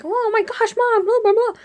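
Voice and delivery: high-pitched mock panic; dramatized hysterics trailing into blahs; frantic ranting. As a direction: babble on as if panicking. Frantic going on, retold.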